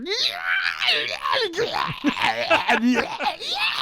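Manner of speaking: high laughing shriek; mock scream. Host imitating a scream from upstairs, laughing shriek. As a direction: screech it out as a joke.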